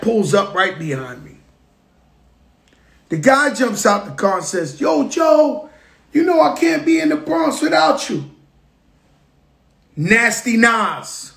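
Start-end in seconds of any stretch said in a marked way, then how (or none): 4.8-8.3 high shouted greeting across the street; excited call. Retold or imitated. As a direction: yell it out like spotting a friend from the car. Nas jumps out calling to him.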